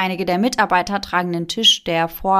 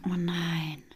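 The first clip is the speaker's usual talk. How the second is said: whispered; 3 semitones lower, 11 dB quieter than usual. Real, not acted. Reacting to scary story.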